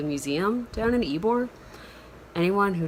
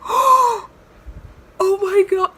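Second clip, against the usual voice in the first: very high, loud, drawn-out fan squeal; much higher and louder starstruck oh my god; 10 dB louder, much slower than usual. Retold or imitated. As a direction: shriek like a fan meeting her idol. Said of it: "I went, gasps".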